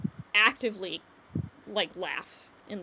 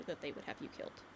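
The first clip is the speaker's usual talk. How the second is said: hushed warning; 8 semitones lower, 14 dB quieter than usual. Acted. GM's character warns quietly.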